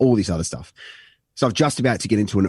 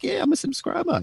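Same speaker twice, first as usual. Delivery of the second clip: much higher, mock wife voice; imitating wife. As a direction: squeak it out as the wife. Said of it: "and she's like".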